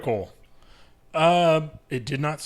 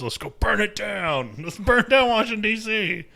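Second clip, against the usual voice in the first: mock rallying cry; 8 semitones higher than usual. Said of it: "U S nation's been mobilized".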